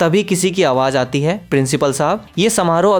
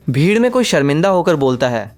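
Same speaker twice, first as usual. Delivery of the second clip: plain reading voice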